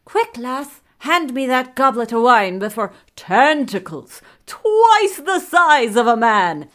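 loud, high, jumping between frantic voices; possessed babbling. A narrator's reading. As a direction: blurt fragments wildly, swelling into a shriek. Many souls babble through king.

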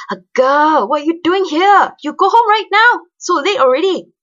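loud, high-pitched angry Makcik; angry auntie scolding. A narrator's reading. Undead auntie scolding a girl out late, high angry shouting. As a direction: scold like an outraged auntie, shrieking it. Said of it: "angry Makcik croak".

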